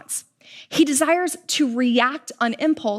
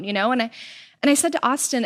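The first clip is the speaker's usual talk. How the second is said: ordinary talk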